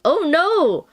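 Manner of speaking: high-pitched dismay; dismayed reaction. Real, not acted. Reacting to cards lost on road.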